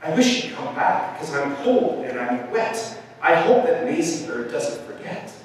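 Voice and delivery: mildly complaining elephant voice; a touch of miserable worry; grumbling elephant. A narrator's reading. Cold wet elephant wishes bird back, grumbling. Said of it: "the poor elephant grumbled".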